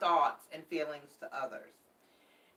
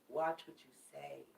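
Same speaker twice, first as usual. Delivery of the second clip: softer, hushed caution; quiet warning; 2 semitones lower, 9 dB quieter than usual. Real, not acted. Tarot reader cautioning viewers about retrograde, hushed. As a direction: lean in and warn softly. Repeated warning, dropping lower.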